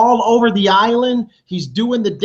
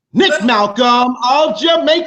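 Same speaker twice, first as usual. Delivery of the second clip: shouted announcement in a put-on Jamaican accent, pitch up; loud, playful fake Jamaican accent; 5 semitones higher, 4 dB louder than usual. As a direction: hype him up in a mock Jamaican accent. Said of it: "my Jamaican accent is false".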